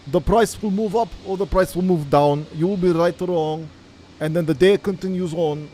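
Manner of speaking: mock Russian accent. YouTube trader opening his video to viewers in a put-on Russian accent. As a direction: lay on a thick joke Russian accent to tease nervous traders. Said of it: "I can't do this accent the whole call".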